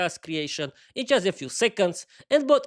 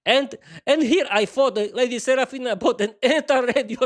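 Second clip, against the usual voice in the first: amused remark; 6 semitones higher, 5 dB louder than usual. Acted. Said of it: "Peter allowed himself a funny remark".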